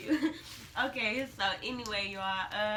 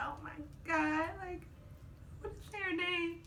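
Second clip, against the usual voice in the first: ordinary talk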